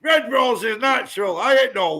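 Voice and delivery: loud, high boast; a chest-out bellow for the Red Rose; proud shout. Real, not acted. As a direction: shout your county pride.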